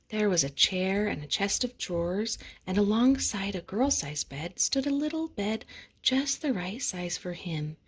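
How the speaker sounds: usual speaking voice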